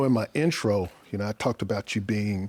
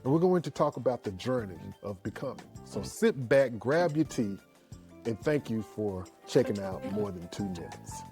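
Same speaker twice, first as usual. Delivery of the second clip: podcast intro read; 3 semitones lower, 5 dB quieter than usual. Acted. Host reads podcast intro over music.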